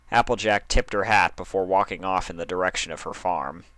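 plain reading voice

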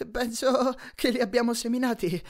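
out of breath. Acted. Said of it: "con il fiato grosso".

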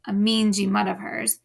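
plain reading voice

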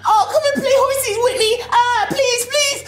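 put-on high, whiny begging kid voice; mock the son pleading for a snack; high-pitched childish whine, hammed up; mocking imitation of his son. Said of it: "Your imitations are so horrible".

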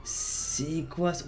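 slow, drawn-out and soft; staring at the riot, drawn out; stunned disbelief. Real, not acted. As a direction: let it hang in disbelief.

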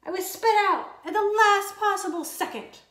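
ordinary delivery, nothing marked

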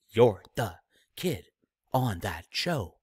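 a lightly voiced Shatner, slow and unimpressed; Shatner asking. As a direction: drawl the question like a bored star. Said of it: "Shatner asked".

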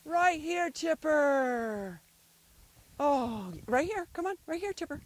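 loud, high call, name drawn out. Real, not acted. Owner guiding dog back, calling out.

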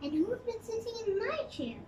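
Mommy Bear moaning. A narrator's reading. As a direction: lift the voice and moan it gently. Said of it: "moaned Mommy Bear".

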